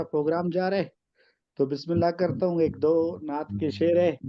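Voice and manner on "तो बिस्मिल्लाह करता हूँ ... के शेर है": ordinary delivery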